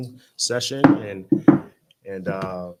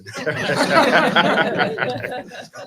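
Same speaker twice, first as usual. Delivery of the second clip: laugh; 13 semitones higher, 12 dB louder than usual. Real, not acted. Joking about the first meeting.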